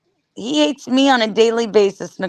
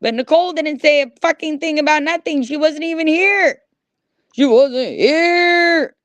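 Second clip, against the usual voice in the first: heated; 6 semitones higher than usual. Real, not acted. Defending Nicole heatedly.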